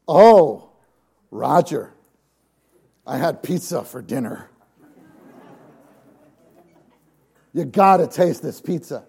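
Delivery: loud, eager oh to a friend; excited about pizza. Shown as a demonstration. Preacher mimicking a friend raving to Roger about pizza, eager. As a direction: rave about the food. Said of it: "I might say like this".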